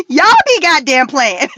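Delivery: high laughs around the words; laughing. Real, not acted. To viewers about the Caillou thumbnail, laughing. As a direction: crack up at the joke thumbnail.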